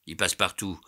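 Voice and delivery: usual speaking voice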